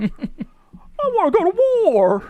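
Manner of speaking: high, drawn-out old-Hollywood star impression; Jimmy Stewart. Actor begging to enlist for war, Jimmy Stewart impression. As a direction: plead slowly in an exaggerated high voice. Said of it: "the James Stewart voice".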